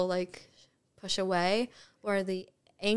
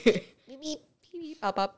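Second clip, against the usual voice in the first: Minion voice; 6 semitones higher, 5 dB louder than usual. Pretend. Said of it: "that's a Minion".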